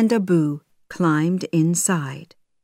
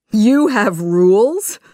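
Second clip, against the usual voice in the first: amused laugh; 4 semitones higher, 6 dB louder than usual. A narrator's reading. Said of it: "Aladdin laughed".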